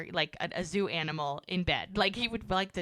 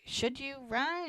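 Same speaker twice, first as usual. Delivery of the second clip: hesitant, higher-pitched worried question; tentative imitation of a worried partner; 8 semitones higher than usual. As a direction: voice mild concern, slowing and rising on the retold question. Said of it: "He just looks worried".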